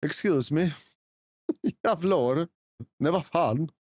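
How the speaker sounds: swearing in Swedish; mock-offended Swedish character; Swedish accent. Streamer answering hecklers of the accent, Swedish accent. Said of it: "in my lovely Swedish accent".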